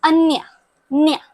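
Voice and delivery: अन्य said as "anya", n and y blended; ñ sound demo. Shown as a demonstration. Teacher shows the Spanish ñ to students, blending n and y. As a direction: glide न्य as one nasal "nya". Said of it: "इसको बोलते हैं".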